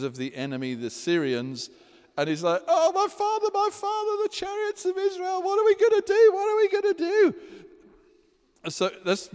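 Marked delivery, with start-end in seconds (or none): 2.7-7.3 high, hammed-up panicky king voice; panicking king. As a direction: play the frantic king begging the old prophet. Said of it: "that's my paraphrase".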